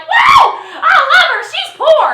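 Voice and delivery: loud mock crowd cheer. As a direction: whoop like a delighted audience. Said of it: "y'all were like".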